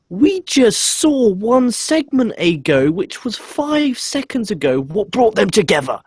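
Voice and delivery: high, loud, slow indignation; exasperated rant. Real, not acted. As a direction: spell it out in loud disbelief. Ranting at the interviewer's question.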